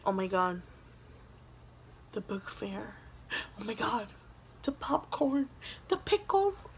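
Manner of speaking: very soft, stunned murmur; the realization sinks in quietly; hushed dawning horror. Retold or imitated. Realizing she spent the money.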